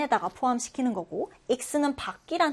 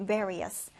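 various with the stress on VAR; first-syllable stress; 5 semitones lower, 6 dB quieter than usual. Shown as a demonstration. Teacher modelling various for learners, first-syllable stress. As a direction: lean on the first syllable of various, let the rest fall. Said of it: "이번엔 첫 음절의 강세 두고요".

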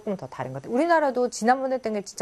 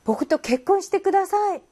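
high, loud, slow acted plea; pleading suitor, pitched way up; earnest proposal; 11 semitones higher, 5 dB louder, much slower than usual. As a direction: propose marriage in a hammed-up voice. Acting out a marriage proposal.

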